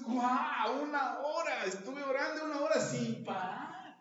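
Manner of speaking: amazed excitement. Retold or imitated. Preacher voicing a believer thrilled at an hour of prayer. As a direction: crow about praying a whole hour.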